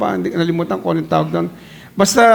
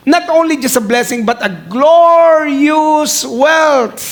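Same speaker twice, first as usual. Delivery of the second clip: slow ringing glorious wealth; emphatic proclamation; 6 semitones higher, 3 dB louder, slower than usual. Acted. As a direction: stretch out glorious wealth slowly and loud. Preacher proclaiming the blessing.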